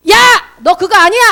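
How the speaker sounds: loud, high bellow of correction; booming demonstration of shouting; mock scolding shout. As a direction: yell it like a blunt telling-off. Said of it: "이렇게 얘기하는 스타일이 아니에요. 저처럼 얘기 안 해요".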